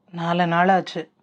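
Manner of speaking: low, drained reply with no feeling; emotionless. A narrator's reading. Raghu answers Jeeva that it has been four days, emotionless. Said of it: "ரகுவின் குரலில் உணர்வுகளே இல்லை".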